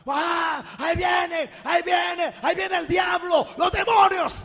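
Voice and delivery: high, loud terrified shrieks; panicked screaming. Retold or imitated. Israelites panicking in the desert, screaming in terror. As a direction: scream in panic as if demons are coming. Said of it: "comienzan a gritar".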